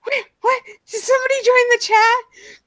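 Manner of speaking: high, startled double take; surprised. Retold or imitated. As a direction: pop up in sudden surprise. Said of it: "I'm like".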